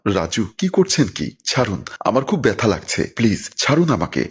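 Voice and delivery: plain reading voice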